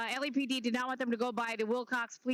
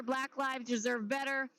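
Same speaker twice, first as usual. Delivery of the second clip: usual speaking voice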